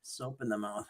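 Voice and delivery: soft, hushed joking self-scolding; tut-tut at yourself, mock ashamed; mock self-reproach. Joking about her own swearing.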